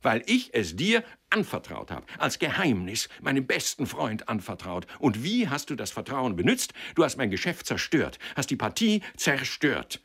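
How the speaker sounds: indignant, punched reproach; thundering accusation. A narrator's reading. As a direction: drive home the betrayal. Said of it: "donnerte Türkisch Gelb".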